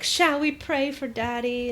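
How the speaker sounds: put-on high little-girl voice; Janie. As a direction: imitate a child asking earnestly. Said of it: "And then Janie's like".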